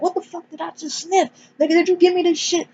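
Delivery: very high-pitched panicked imitation; mock freak-out. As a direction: squeak it out like someone freaking out. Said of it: "And he's freaking out".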